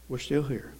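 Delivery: We're still here dropped low and soft; hushed repeat of We're still here; quiet echo. Shown as a demonstration. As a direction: say it again almost under the breath.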